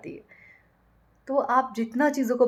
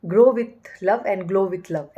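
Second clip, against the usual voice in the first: very slow and louder, each word spaced out; louder slow-motion recital of a motto; slow quoted motto; 7 dB louder, much slower than usual. Retold or imitated. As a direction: spell out the motto slowly, savouring it. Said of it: "इसमें बोला था".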